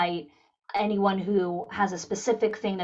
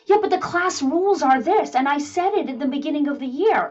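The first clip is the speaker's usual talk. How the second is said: a higher, louder imitation of a complaining teacher; mock teacher; 7 semitones higher, 3 dB louder than usual. Imitating a teacher insisting the two-year-old follow class rules, higher and louder. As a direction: lightly play the rigid teacher quoting class rules.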